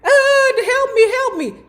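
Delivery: high, whiny cries for help, hammed up; mock a grown man begging; mock pleading whimper. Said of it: "You got Jack Gordon in the chair".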